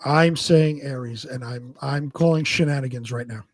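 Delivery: ordinary talk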